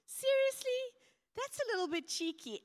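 high, loud scandalised seriously; indignant disbelief. Retold or imitated. Preacher reacting to the prophet, loud and indignant. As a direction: act shocked at the cheek. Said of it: "I was like".

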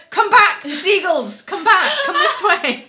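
high, loud, alarmed calls; urgent shouting. Retold or imitated. Man calling her back from the seagulls, urgent shouting. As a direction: yell at someone walking into danger. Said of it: "urgency in this man's voice".

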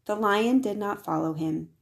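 ordinary delivery

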